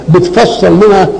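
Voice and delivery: ordinary delivery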